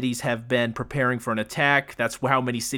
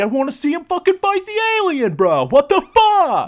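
put-on much higher and louder, whiny kid complaining; mock whiny kid; 6 dB louder than usual. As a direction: whine like a cheated young moviegoer. Imitating frustrated kid viewer.

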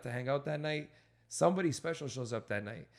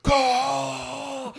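high loud whooping cry; crowd roar; 11 semitones higher, 7 dB louder than usual. Retold or imitated. Comedy club erupting when the heckler drops, high loud whoop. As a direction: whoop like a crowd going wild.

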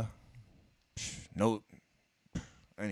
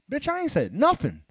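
high, loud mock outrage; indignant retort; 12 semitones higher, 5 dB louder than usual. Customer protesting to the window girl, indignant. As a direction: fire back in a high, offended protest. Acts out arguing with window worker.

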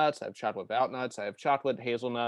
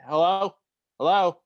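raised, rising hellos into a dead headset; calling out; 5 semitones higher, 7 dB louder than usual. Acted. Gamer calling into a silent headset, raised rising voice. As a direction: shout hello, is anyone there.

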